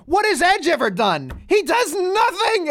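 imitated angry outburst, climbing high; Seth Rollins. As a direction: act out an exasperated wrestler, pitch soaring on the last words. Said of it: "he was so angry".

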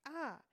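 short high mock scream. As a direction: yelp it like the screaming plant. Imitates the screaming plant.

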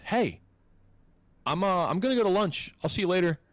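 high-pitched, casual mock coworker; imitated coworker. Pregnant coworker telling him she is off to lunch, imitated high. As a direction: put on a high voice for a breezy goodbye. Acting out departing coworker's lines.

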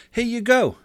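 lightly voiced little boy, pitched high; high, breezy kid handing it off; carefree boy. Carefree boy tosses his toy over.

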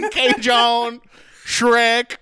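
high loud mock Cajun; Cajun man. Host hamming the Cajun man, loud and high. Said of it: "real life Cajun man".

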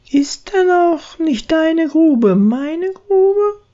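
high, slow, resolute mouse; tiny mouse deciding to follow; squeaky mouse voice. Acted. Braut entschließt sich. (langsam, hohes Stimmchen)

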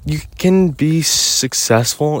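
high and drawn out, fed up; exasperated outburst. Real, not acted. Teen venting at people doubting her plans, exasperated. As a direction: tell the naysayers to shut up, slowly.

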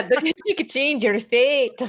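slow, lilting Scottish line; a Brave-style Scottish accent. Pretend. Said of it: "Like, that one I got".

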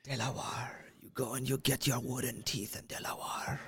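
whispered, hushed and secretive; mock whisper. Joking secret instructions to friend, whispered. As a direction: murmur it like a shady deal.

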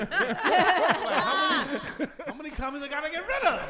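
high, slow laughing question. Real, not acted. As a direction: laugh through the words.

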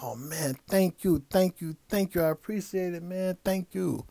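overjoyed stranger thanking him for the five dollars; excited thanks. Retold or imitated. Grateful panhandler thanking excitedly.

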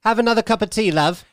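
mock English accent. Mocking the royals as an English lady. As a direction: sweetly offer tea, English accent.

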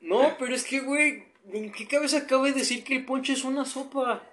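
agudo y lento, indignado; incrédulo. Real, not acted. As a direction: pregunta con asombro burlón. Incrédulo ante el ponche sopa. (indignación incrédula)

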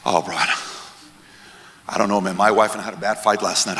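low, soft, slow troubled sigh of a reply; mock downcast. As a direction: heave it out wearily, like a man acting glum. Acted troubled short-term thinker answering.

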